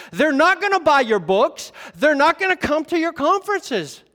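loud, high, driving pulpit voice; full-force preaching. Acted. Pastor preaching to his church at full force. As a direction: drive it home to the congregation. Preacher mocking ministry logic.

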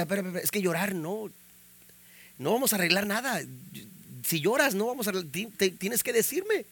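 quick, very soft retold appeal; hushed pleading. Husband retelling his appeal to crying young wife, hushed and quick. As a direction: reason softly with someone in tears.

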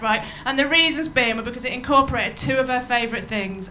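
usual speaking voice

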